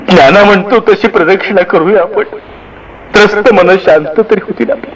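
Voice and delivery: a hint of a trembling, tearful father; trembling words. A narrator's reading. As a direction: speak with a quiver of emotion. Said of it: "कापऱ्या शब्दात".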